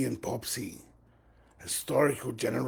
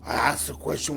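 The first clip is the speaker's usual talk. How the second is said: normal talking voice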